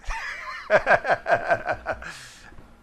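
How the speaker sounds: a real, open laugh of delight; hearty laugh. Real, not acted. Hearty laugh after the answer.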